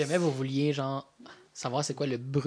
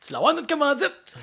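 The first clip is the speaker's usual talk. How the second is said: much higher, louder happy cheer; excited; 12 semitones higher, 7 dB louder than usual. Real, not acted. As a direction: cheer it out, thrilled. Cheering the sponsor mention.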